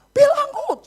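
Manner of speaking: very high, loud scream of command; reenacted parent at full yell; imitated father yelling. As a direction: scream the order at the child. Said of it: "Sampai saya teriak".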